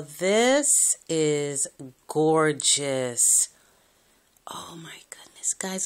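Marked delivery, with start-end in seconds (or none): 4.5-5.9 breathless hushed whisper; awed whisper. Real, not acted. Vlogger gazing at the gift, hushed awed whisper. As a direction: whisper it in wonder.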